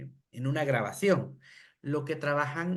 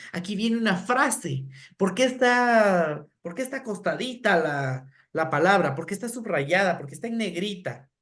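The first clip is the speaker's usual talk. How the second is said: preguntas lentas y agudas para los niños; preguntas exageradas; 3 semitones higher than usual. Shown as a demonstration. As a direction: pregunta con asombro didáctico. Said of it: "lo que hacemos es exagerar". (maestra pregunta exagerando a los niños)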